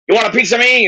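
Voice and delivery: high-pitched sitcom challenge; George. Retold or imitated. Quoting a Seinfeld line from the tapes, mock combative. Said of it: "like when George said".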